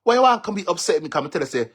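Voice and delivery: ordinary talk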